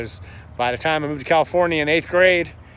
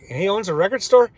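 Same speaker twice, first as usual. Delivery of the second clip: mildly incredulous, higher-pitched question; puzzled disbelief; 7 semitones higher, 3 dB louder than usual. Retold or imitated. Two walkers questioning Ray's odd claim, in puzzled disbelief. Retold puzzled reply to Ray.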